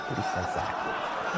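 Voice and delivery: soft unvoiced whisper, half to himself. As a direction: breathe it out as a dry aside. Whispered aside after bubble joke.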